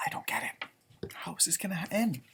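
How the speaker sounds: unvoiced sighing whisper; puzzled whisper to himself. Real, not acted. Puzzling over the story, whispered.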